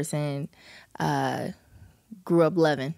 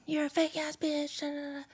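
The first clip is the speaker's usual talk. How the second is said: high, soft retold scream; mock scream; 9 semitones higher, 8 dB quieter than usual. Singer retelling someone screaming insults at them, mock scream held down. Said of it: "they start screaming".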